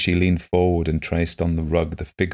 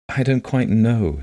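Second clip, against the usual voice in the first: slow, higher, artless reply; simply; 6 semitones higher, 3 dB louder, slower than usual. A narrator's reading. Blind woman answering how many children, simply. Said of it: "she said simply".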